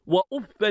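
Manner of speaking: وأفا ending in "an", "uffan"; accusative tanwin. Shown as a demonstration. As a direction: say وأفا as "wa-uf-fan". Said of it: "بالنصب والتنوين".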